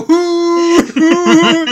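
loud high cat hoots; cats hooting. Pretend. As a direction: hoot like a cheering cat. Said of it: "And they go".

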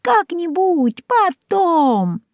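put-on high, slow and lazy old cat voice; brushing off the question, slow and high; old cat Кузя. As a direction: drawl it out like a sleepy old tomcat. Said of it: "ответил Кузя".

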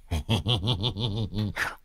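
gleeful villain's chuckle; cackling laugh. A narrator's reading. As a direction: chuckle away in a quick steady run. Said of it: "cười khanh khách".

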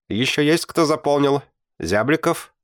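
calling out to class. Acted. Teacher asks the class who has filled in cards, calling out.

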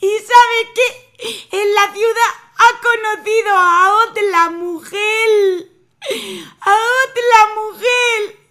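high, loud wailing complaint, half crying; wife tells her mother of betrayal, sobbing. Acted. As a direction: wail it to mother through tears.

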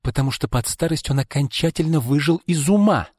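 high-pitched irritated outburst; irritated cry. A narrator's reading. Izolda snaps back about their late father, irritated. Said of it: "раздражённо крикнула Изольда".

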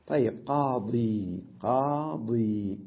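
قاضي said very slowly with a long final ي; slow pronunciation demo. Shown as a demonstration. Teacher slowly sounding out قاضي for the class. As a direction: draw out قاضي so every sound is heard. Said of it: "هي أصلها قاضي".

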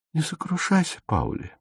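soft, lifeless comfort; dead voice. A narrator's reading. Дагмар утешает Пауля мёртвым, тихим голосом. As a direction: say it as if nothing is left. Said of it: "Голос Дагмар был мёртвый".

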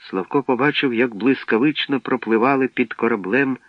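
usual speaking voice

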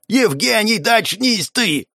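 high-pitched, drawn-out yell. A narrator's reading. Alex shaking Evgeny awake, yelling. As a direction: yell to bring him round, slowly and high. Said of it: "заорал в ухо, что было силы".

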